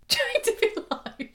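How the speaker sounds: high laughing echo of a phrase. Retold or imitated.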